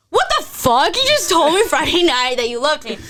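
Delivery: high, loud retold outburst; outraged disbelief. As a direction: reenact exploding at a breakup text. Reacting to breakup text.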